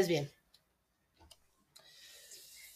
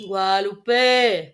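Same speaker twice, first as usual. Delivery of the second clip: slow drawn-out call, a bit louder; long calling shout; 6 dB louder, much slower than usual. Shown as a demonstration. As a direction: shout the name slowly across the room. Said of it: "Pero sostén la E".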